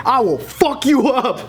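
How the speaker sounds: high, slow retold threat; mock threat. Man at airport scaring college kids, mock menace.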